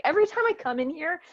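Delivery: high, hurried mock complaint; dad's exasperation. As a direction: imitate a baffled dad griping. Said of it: "He's like".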